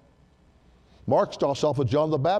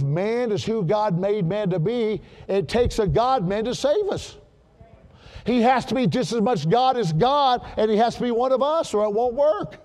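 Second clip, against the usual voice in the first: preaching at full force; 5 semitones higher than usual. Acted. Preaching climax.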